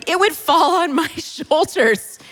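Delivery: normal talking voice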